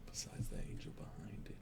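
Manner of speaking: unvoiced whisper. Real, not acted. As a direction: breathe it as a secret aside. Whispered aside at table.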